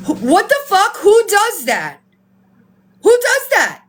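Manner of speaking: high, incredulous outburst; appalled, rising indignation; outraged. Real, not acted. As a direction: demand it in disbelief, pitch climbing. Outraged at charity fraud.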